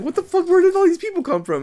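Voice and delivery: high, squeaky what-the-hell; shocked disbelief. Retold or imitated. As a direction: shriek the shock out. Disbelief at the runners.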